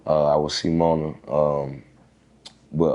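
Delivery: usual speaking voice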